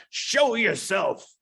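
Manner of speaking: high, drawn-out call into a room. Acted. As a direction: call it out slowly on a high pitch. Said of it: "calls out".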